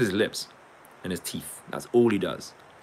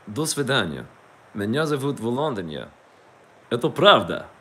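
higher-pitched put-on Russian voice; 4 semitones higher, 5 dB louder than usual. Introducing himself in Russian as Volodya, put-on Russian. Performs Russian phrases for listeners.

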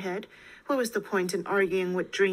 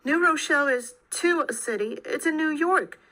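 raised voice, higher and louder; getting louder, panicky; 5 semitones higher, 4 dB louder than usual. A narrator's reading. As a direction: insist with rising panic. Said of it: "Hannah could feel her voice getting louder".

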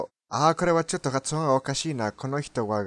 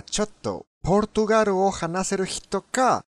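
ordinary delivery

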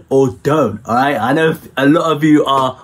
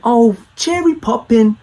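high, hammed-up posh British accent; mock posh British accent; 8 semitones higher than usual. Londoner mocking how Americans think Brits talk, hammed-up posh British accent. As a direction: squeak it out like a caricature toff. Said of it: "the whole accent".

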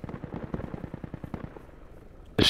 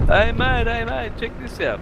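much higher, loud hail; high gloating call; calling out boastfully; 14 semitones higher, 4 dB louder, slower than usual. Retold or imitated. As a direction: shout over to him, gloating. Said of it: "he goes".

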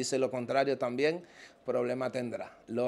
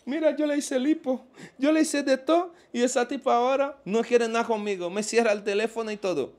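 much higher, whining complaint of a jilted man; mock whiny lament; 11 semitones higher, 3 dB louder than usual. Imitating a rich man whining that his girlfriend left him, high mock whine. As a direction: whine about the woman who dumped you, hammed-up.

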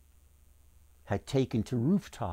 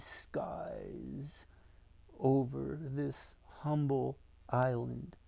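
soft, slow, hushed musing; anxious inner thought; 10 dB quieter, slower than usual. A narrator's reading. Lucas wonders at a miracle in the skies, hushed and slow. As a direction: think it under the breath, slowly. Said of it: "Lucas thought".

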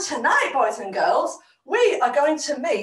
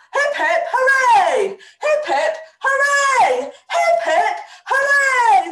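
high, excited hip hip hooray; rousing three cheers; 10 semitones higher, 3 dB louder than usual. Real, not acted. Host leading children in cheers, high and rousing. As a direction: cheer it out, drawing out each hooray.

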